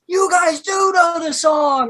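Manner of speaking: pumped-up stranger. Retold or imitated. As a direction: sound thrilled and amazed. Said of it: "he goes".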